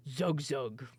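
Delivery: lightly voiced orc grunt; a hint of a simple-minded orc. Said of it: "an orc that was just kinda like".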